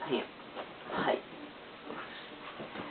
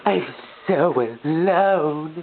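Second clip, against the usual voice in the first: mock lonely; 6 semitones higher, 6 dB louder, much slower than usual.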